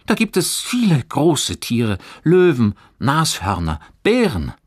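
high, loud, enthusiastic list; excited. A narrator's reading. Johannes tempts his friend with big animals, excited. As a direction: sell each animal with relish.

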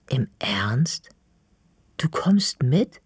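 breathless whispered disbelief; slow whispered question, almost afraid to ask. A narrator's reading. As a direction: hush it out, stunned. Stunned girl asks in disbelief.

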